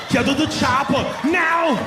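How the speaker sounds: high, drawn-out action-hero shout; urgent movie catchphrase, stretched out; Arnold Schwarzenegger. Acted. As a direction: yell it as a thick-accented action star. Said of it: "He says that in every movie".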